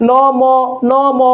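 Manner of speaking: "নম" drawn out for repeating, vowels held; pronunciation drill. Shown as a demonstration. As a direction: lead a class through a slow "নম". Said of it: "একটু আমার সাথে সবাই উচ্চারণ করেন তো".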